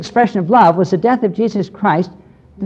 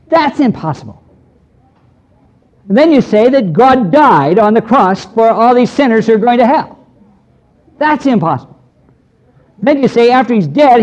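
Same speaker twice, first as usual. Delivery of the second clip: higher and louder, piling up each impossible point; retold scornful rant; 5 semitones higher, 4 dB louder than usual. Skeptical dentist mocking Christians to the preacher, loud scornful rant. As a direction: hammer every claim as a scoffing skeptic. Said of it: "he said it something like this".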